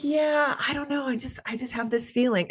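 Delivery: voicing mom. Mom voiced, calm and knowing.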